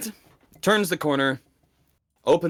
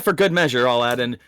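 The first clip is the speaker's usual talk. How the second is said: usual speaking voice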